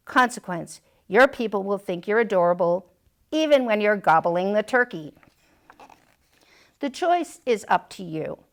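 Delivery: ordinary delivery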